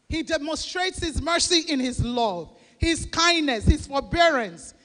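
usual speaking voice

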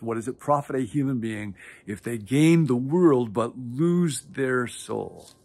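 normal talking voice

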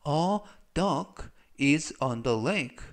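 very slow, careful English sentence; slow model reading. Shown as a demonstration. Teacher modelling sentence slowly for learners. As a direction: spell out each word. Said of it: "즉 전체적 의미는".

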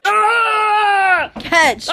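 excited shout; playful yell. Real, not acted. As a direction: shout catch while throwing. Throwing a toy at partner.

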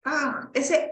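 usual speaking voice

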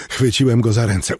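plain reading voice

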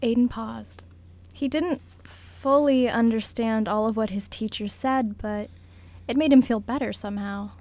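normal talking voice

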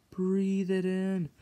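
drawn out slowly and softly; slow breathy-sounding invitation; slow dreamy. Acted. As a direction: stretch it out like a trance. Coaxing SpongeBob to smell patty.

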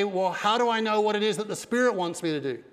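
ordinary talk, nothing marked

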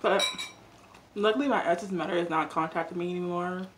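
talking while chewing; mouth full. Real, not acted. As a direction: food in mouth. Seen 2: Bite taken at -2; closed-mouth chewing while talking, no prior drink.

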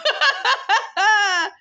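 a loud, high burst of laughter. Real, not acted. Laughing at the man's bad choice, loud burst. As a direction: crack up out loud.

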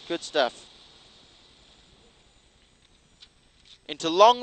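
loud, urgent co-driver calls; rally pace notes. Real, not acted. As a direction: bark out corner calls over the engine.

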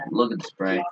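low muttered aside; quiet mutter. Real, not acted. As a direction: mutter it under your breath. Gaming, pointing out enemy spray.

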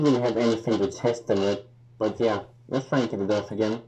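muffled behind rubber mask; speaking inside sealed respirator; through a gas mask. Real, not acted. Seen 5: Full rubber gas mask covers face while speaking; removed by 2.8.

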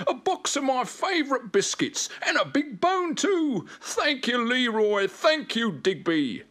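high, excited puppy voice; thrilled. A narrator's reading. Spot thanking Leroy and Digby, high and thrilled. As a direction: gush thanks in a high pup voice.

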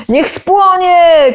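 high, slow, loud mob cry; shout. A narrator's reading. Someone in the crowd shouting for her burning, loud. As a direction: scream it for the fire. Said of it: "krzyknął ktoś z tłumu".

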